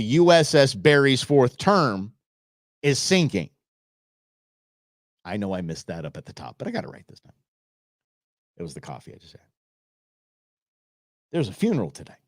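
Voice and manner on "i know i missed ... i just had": hushed aside. Real, not acted. Aside after a flub.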